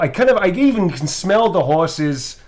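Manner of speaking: a hint of a Boston accent. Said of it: "I really don't do the Boston accent very well".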